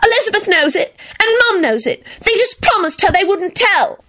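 high-pitched indignant squeal; high, outraged protest; furious squeal. A narrator's reading. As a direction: insist in a girlish squeal. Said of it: "Rosie squealed furiously".

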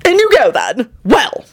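high, officious calling out. A narrator's reading. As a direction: call out with fussy self-importance. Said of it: "he called out officiously".

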